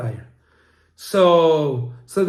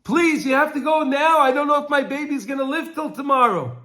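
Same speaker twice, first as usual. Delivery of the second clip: retold frantic scream; 9 semitones higher than usual. Mother begging her friend on the phone, high frantic pleading. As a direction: beg like a mother afraid for her baby. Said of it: "She starts screaming".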